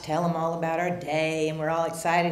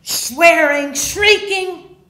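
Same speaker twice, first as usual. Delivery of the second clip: mock shriek; 8 semitones higher, 6 dB louder, much slower than usual. Drunk arrival, retold screaming.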